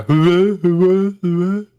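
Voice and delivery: high echoing hello, fading; cave echo. Acted. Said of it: "there's a little bit of an echo".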